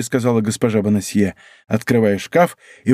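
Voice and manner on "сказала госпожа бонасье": usual speaking voice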